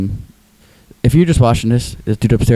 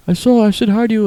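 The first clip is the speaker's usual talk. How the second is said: high, plaintive mimicry; put-on high voice, slightly hurt; Tim impression; 12 semitones higher than usual. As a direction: imitate his complaint in a pitched-up voice. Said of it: "he talks like this".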